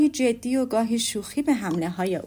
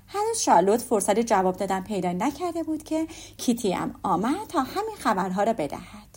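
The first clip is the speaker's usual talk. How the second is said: usual speaking voice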